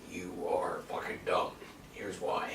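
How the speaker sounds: mock Snoke. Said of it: "He was just like".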